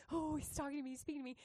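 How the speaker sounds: very soft, voiced murmur; soft imitated inner voice; hushed realisation. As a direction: murmur the discovery to yourself.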